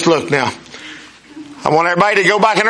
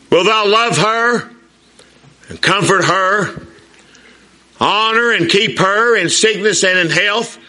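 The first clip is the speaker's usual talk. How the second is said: put-on young groom's papa asking the vows; wedding officiant; 4 semitones higher than usual. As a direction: read the vows like a minister at the altar. Reciting the wedding vows slowly.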